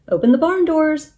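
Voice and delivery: high, loud call-out; yelling. A narrator's reading. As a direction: yell it out as an order. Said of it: "Minnie yelled".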